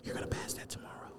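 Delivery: mock-soothing whisper; hushed, breath-only ASMR parody. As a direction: whisper very softly into the mic. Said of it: "A S M R portion of the podcast".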